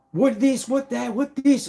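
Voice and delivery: high, mocking parody of a nagging official; mocking nagging lady. Mocking the court representative's nagging questions, high and snide. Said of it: "And she was evil. She was nasty".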